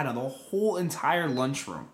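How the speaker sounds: ordinary talk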